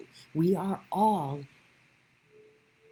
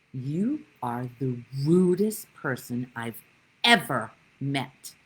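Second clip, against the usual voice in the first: retold angry rebuke; 3 semitones higher, 6 dB louder than usual. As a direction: scold her like an irate neighbour at the pool. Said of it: "Yeah, he was angry".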